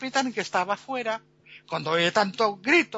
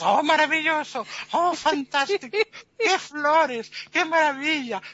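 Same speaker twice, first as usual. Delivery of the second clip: high, hammed-up oohs; gushing admiration; 6 semitones higher, 4 dB louder than usual. The crowd pretends to see marvels, high gushing delight. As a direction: gush loudly to prove you see it.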